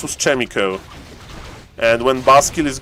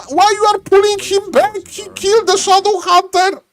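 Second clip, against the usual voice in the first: much higher, near shouting; frantic caster. Real, not acted. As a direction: scream the play as it unfolds. Frantic caster fight call.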